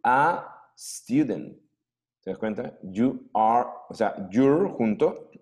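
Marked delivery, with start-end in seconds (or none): 0.0-1.6 slow model phrase. Shown as a demonstration. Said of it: "Diferente que yo dijera".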